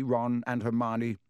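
ordinary delivery

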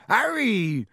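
loud, high call of Harry!; Hagrid calling out. A narrator's reading. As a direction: shout Harry! across the alley. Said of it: "the massive form of Hagrid".

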